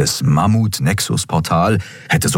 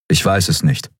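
understated earnest reply. A narrator's reading. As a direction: answer soberly and simply. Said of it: "antwortete Kai ernst".

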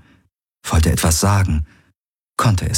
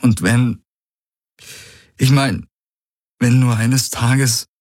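higher, halting, a touch of trembling; 6 semitones higher than usual. Acted. Man struggling to explain to his partner, faintly trembling. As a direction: falter upward, barely holding the feeling back. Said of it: "Das Beben ging nun auch in seine Stimme über".

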